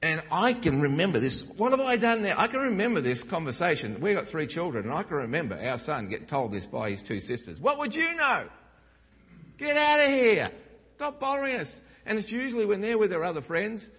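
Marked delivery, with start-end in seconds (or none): 1.6-2.3 high, loud wounded protest; a younger brother wailing his innocence; indignant kid. Retold or imitated. Young son crying out after his sisters tell him off. Preacher voicing the younger child. 7.6-11.8 put-on much higher, loud bossy girls' voice; mocking sisters. Sisters shooing away their little brother, loud and high.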